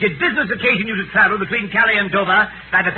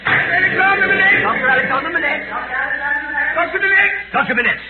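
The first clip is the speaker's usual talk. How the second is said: calling out; 5 semitones higher than usual. Acted. Crier summoning the doctor to the stand, calling out.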